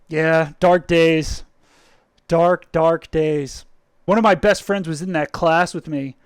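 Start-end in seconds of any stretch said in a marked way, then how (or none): 0.1-3.6 very slow, mock grave lament; mock solemn. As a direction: drag it out like a tragedy.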